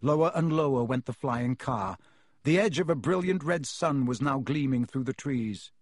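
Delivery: usual speaking voice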